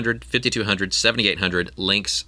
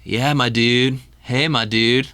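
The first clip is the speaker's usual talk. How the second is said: higher surfer-dude stoner voice; Argyle; 4 semitones higher, 4 dB louder, slower than usual. Acted. Stoner greeting people every time he talks, surfer-dude impression. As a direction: imitate a laid-back surfer dude. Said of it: "he talks like this surfer, you know, dude accent".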